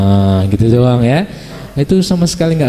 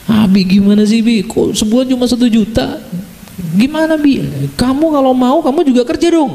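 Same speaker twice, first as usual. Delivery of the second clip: whiny wife; 7 semitones higher than usual. Retold or imitated. Wife complaining about husband's income.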